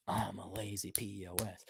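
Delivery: very soft, low mumbled repeat; meek echo. Acted. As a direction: echo it meekly under your voice.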